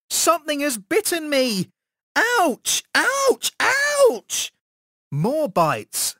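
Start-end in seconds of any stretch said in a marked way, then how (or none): 0.1-4.5 very high cries, climbing higher; screaming in pain. A narrator's reading. Boy being bitten by ants, screaming in pain. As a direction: yelp higher with each bite. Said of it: "I screamed".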